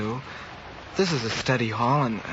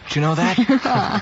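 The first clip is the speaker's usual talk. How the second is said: playful boast; 8 semitones higher, 5 dB louder, slower than usual. Acted. Teasing boast to classmate Sue.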